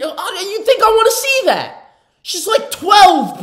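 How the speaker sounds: high, loud, slowed disbelief; shocked outburst. Real, not acted. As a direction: recoil in horrified shock. Shocked by clip content.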